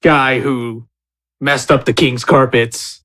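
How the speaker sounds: normal talking voice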